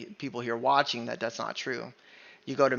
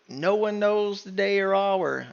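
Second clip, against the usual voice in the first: higher, louder and slow, mocking the saying everyone loves; mocking the popular verse; 5 semitones higher, 4 dB louder, slower than usual. Preacher mocks the verse his listeners love to quote, mocking. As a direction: quote it the way people love to repeat it. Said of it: "what people love".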